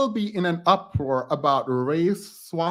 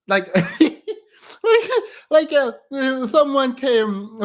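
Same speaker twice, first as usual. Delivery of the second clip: giggling through the words, higher and louder; laughing start; 8 semitones higher, 6 dB louder, much slower than usual. Real, not acted. Starting the story about the live stream while laughing.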